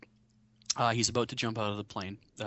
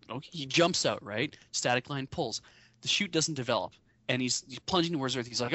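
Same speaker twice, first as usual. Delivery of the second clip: normal talking voice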